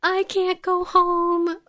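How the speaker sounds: high, slow, put-on Irish accent of the Irishman; a clumsy stab at an Irish lilt; bad Irish accent. Imitating the Irishman in the bar with a bad Irish accent. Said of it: "That's not a good Irish accent".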